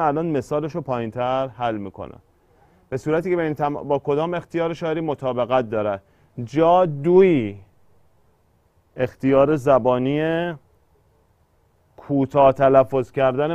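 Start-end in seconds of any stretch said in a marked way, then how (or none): 6.4-7.6 جا دوی drawn out syllable by syllable; measured verse scansion of جا دوی; slow scansion. Shown as a demonstration. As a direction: stretch out جا, then دوی, slowly.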